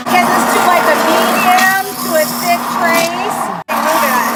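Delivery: loud, high, eager calling out; excited loud instruction. Real, not acted. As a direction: push the point with excitement. Excited instructions while blending.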